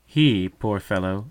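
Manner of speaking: plain reading voice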